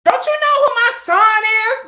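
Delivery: high, loud, outraged demand; indignant shout. Retold or imitated. Mother shouting at store staff, high and outraged. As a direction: demand it like you own the store. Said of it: "I gotta make a fucking appointment".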